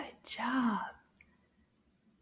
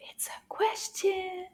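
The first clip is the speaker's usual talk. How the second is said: whisper; 7 semitones higher, 6 dB quieter than usual. Acted. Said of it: "whispered surprise".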